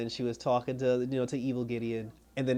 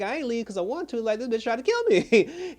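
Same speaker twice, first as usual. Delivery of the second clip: high-pitched, incredulous comeback; mock outrage, pitched way up; retold indignant protest; 10 semitones higher, 4 dB louder than usual. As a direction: protest it in a high voice, building louder. Retold indignant reply to an accusation.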